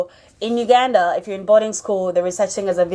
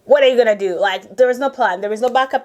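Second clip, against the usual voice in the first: usual speaking voice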